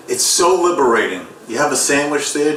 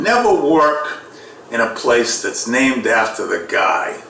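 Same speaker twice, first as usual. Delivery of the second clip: ordinary talk, nothing marked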